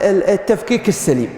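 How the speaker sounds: ordinary delivery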